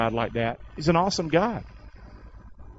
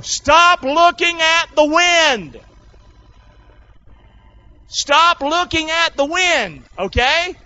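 high, loud, slow command; fiery preacher's plea; preached command; 14 semitones higher, 6 dB louder, much slower than usual. Acted. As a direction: hammer the line home. Climactic sermon command.

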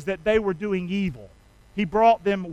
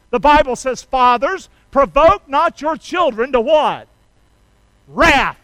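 loud, raised scripture quotation; emphatic preacher cueing the crowd's answer; forceful preaching; 5 semitones higher, 8 dB louder, slower than usual. Acted. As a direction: hammer the verse home from the pulpit.

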